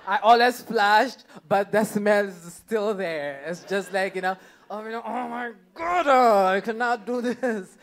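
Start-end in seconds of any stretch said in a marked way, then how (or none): 4.7-7.8 higher, strained mock despair; a hint of toilet desperation; mock panic. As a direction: play it like you can't take it.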